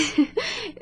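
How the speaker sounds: a quick, high, shy laugh; bashful giggle. Acted. Nurse admits her doubt to the visitor with a shy giggle. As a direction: giggle caught out by the teasing.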